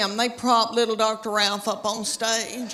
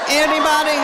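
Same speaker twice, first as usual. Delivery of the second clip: raised voice, higher, playful challenge to the crowd; teasing call-out; 5 semitones higher, 6 dB louder than usual. Real, not acted. Speaker teases the audience, loud playful call-out. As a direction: fling the question out to the room.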